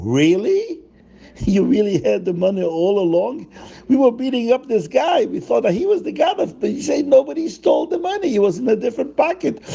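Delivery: can't believe what they hear; astonished crowd. Acted. Townsfolk stunned the money was never stolen, astonished.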